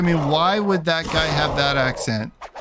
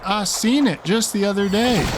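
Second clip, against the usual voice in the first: lightly mock a Southern Texas accent, pitched up; 8 semitones higher than usual. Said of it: "violently abuse the English language by saying".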